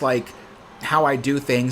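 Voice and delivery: ordinary delivery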